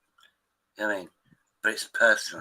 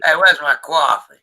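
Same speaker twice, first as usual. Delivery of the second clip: loud, higher-pitched Brooklyn impersonation; Brooklyn accent; 5 semitones higher, 10 dB louder than usual. Retold or imitated. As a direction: call out in a mock Brooklyn accent. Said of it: "She talks like that".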